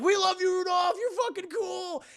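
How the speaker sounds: high, loud cheering voice; adoring crowd cheer. Acted. Whole world cheers Rudolph in retelling.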